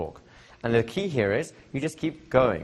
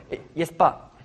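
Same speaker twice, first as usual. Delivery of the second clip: a higher, hesitant yes but; hesitant objection; 7 semitones higher, 4 dB louder than usual. Retold or imitated. Pushback a coaching client gives, hesitant and higher. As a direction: stall with a reluctant yes but. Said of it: "You'll get this".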